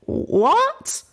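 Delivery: high, startled "What?"; startled question. Acted. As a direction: jolt awake confused. Pip startled awake, confused.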